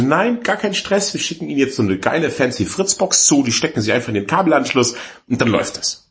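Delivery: high, breezy phone-sales patter; imitated sales rep reassurance; upbeat saleswoman. Saleswoman voiced reassuring a worried customer.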